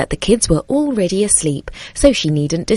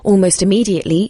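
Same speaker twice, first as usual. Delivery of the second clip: usual speaking voice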